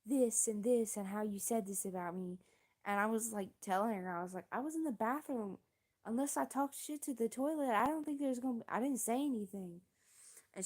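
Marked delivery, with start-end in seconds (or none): 0.0-2.4 mock accusing rant, pitched up; mild mock yell, higher voice; retold yelling. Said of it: "she starts yelling about how". 4.5-9.8 high-pitched, insistent retold denial; acted-out indignant self-defense; exasperated protest. Retold protest against an accusation.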